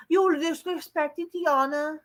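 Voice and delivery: high, slow, mincing mock voice of the wrestler; mocking impression. Vlogger mocking a wrestler's promo voice, high and slow. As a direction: lift the pitch way up and stretch it out mockingly.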